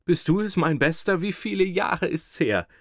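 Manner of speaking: high, loud and overjoyed; excited greeting. Acted. Fat man greeting his school friend, high and overjoyed. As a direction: greet him like a long-lost brother.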